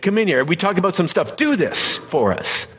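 high, pushy mock command to a spirit; mock demand. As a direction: ham up a bossy request for tricks. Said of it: "I'm not saying".

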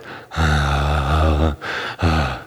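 long, breathy exhale; long releasing sigh. Acted. Resetting his voice for his wife, long sigh. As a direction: let the tension out in a big sigh.

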